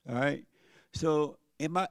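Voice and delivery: normal talking voice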